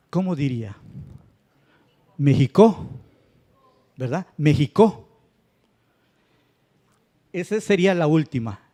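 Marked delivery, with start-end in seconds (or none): 2.2-2.9 "México" said wrongly as me-ji-CÓ; louder, punch the final CO of "México"; stress on last syllable. Shown as a demonstration. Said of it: "si pronunciáramos la última sílaba, que es co, fuerte". 4.4-5.1 "México" said wrongly as me-ji-CÓ; louder, punch the final CO of "México"; stress on last syllable. Shown as a demonstration. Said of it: "si pronunciáramos la última sílaba".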